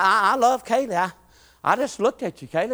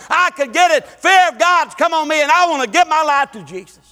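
loud, rising revival preaching; preaching at full force; 8 semitones higher, 5 dB louder than usual. Acted. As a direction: preach it hard to the congregation.

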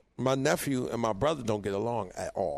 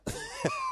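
sudden high burst of laughter; a high startled laugh; 4 dB quieter than usual. Real, not acted. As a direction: crack up in disbelief. Laughing at nephew's remark.

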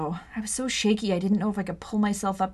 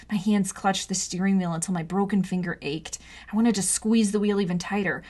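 plain reading voice

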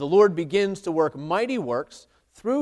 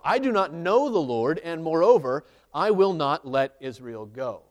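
louder, firm reading of Pharaoh's refusal; scripture reading; 6 dB louder than usual. Acted. Preacher reading Pharaoh's refusal to the congregation, louder. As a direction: read the defiant refusal straight from the text.

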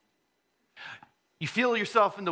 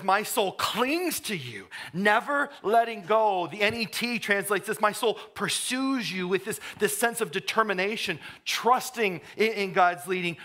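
louder, higher preacher's cry; impassioned preaching; 5 semitones higher, 4 dB louder than usual. Acted. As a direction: preach it out with full fervor. Preaching full force.

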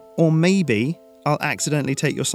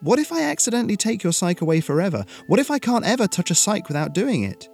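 high-pitched, anxious what-ifs; rising panic; 10 semitones higher, 4 dB louder than usual. A narrator's reading. Boy backing away from his friends, panicked. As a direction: blurt out your worst fears.

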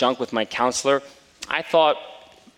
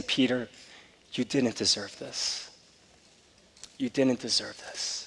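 softer, slow, gentle repeating; tender reassurance; 8 dB quieter, slower than usual. Retold or imitated. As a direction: comfort the hurt boy softly and slowly. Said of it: "Over and over, he kept saying".

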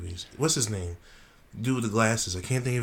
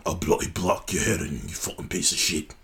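lower, gruff mock-British threat; British tough talk, played for laughs; British Batman; 3 semitones lower than usual. Friend playing Batman threatening criminals, mock British accent. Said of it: "Batman with the accent".

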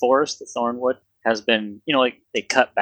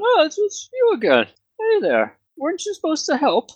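much higher, dopey guard; high dim guard voice. Acted. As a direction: pitch way up like a dumb guard.

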